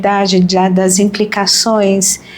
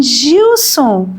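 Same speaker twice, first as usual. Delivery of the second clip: retold surprise; 6 semitones higher than usual. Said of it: "Aí eu parei e falei assim".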